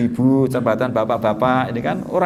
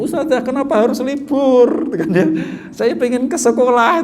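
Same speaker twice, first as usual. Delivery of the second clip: put-on eager child; 9 semitones higher than usual. Child phones teacher about holiday.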